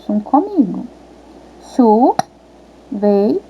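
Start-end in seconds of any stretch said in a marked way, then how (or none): 1.7-3.4 "Chuveiro" very slow, syllable by syllable; separação silábica. Shown as a demonstration. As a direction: lead children through "Chuveiro" in three separate beats. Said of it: "Vamos lá, crianças". ("Chuveiro" pausado, em três sílabas)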